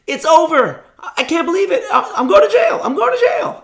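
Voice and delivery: high, loud, laughing hammed-up panic; mock panic. Teen pulled over by police imagines jail, laughing mock panic. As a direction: play the terrified fifteen-year-old for laughs.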